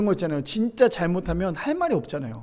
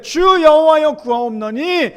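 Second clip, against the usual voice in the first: Samson crying out; 5 semitones higher, 4 dB louder than usual. Acted. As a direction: beg God for strength one last time. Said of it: "여호와께 부르짖어 이르되".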